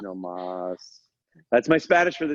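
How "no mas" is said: soft, low, spent surrender; mock pleading. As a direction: give up begging, playfully defeated. Acts out begging for mercy.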